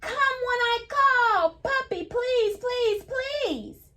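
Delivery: very high, slow sing-song call; storybook reading voice. Acted. Teacher reading the calling page to kids, high slow sing-song. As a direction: call the puppy home, singing it.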